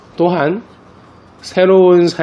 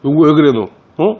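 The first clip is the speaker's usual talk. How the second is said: alarmed concern; 4 semitones higher, 5 dB louder, faster than usual. Retold or imitated. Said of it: "내가 그랬지".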